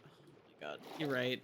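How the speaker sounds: usual speaking voice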